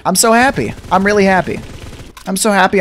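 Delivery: high, giddy happy exclamation; really happy. Real, not acted. Gamer celebrating a long-awaited new feature, really happy.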